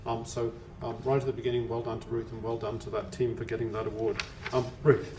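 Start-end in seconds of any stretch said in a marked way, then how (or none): none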